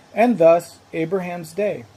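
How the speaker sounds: normal talking voice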